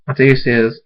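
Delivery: ordinary delivery, nothing marked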